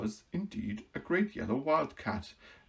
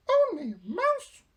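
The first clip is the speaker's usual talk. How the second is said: very high, louder outraged mouse queen; indignant squeak; 7 dB louder than usual. Acted. Mouse queen offended by the Woodman, indignant squeak. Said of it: "cried the little animal indignantly".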